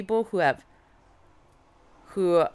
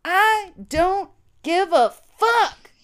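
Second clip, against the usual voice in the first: high, drawn-out triumphant shout; loud, high-pitched reenacted battle cry; yelled; 12 semitones higher, 6 dB louder, much slower than usual. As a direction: yell it out word by word. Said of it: "and I yelled".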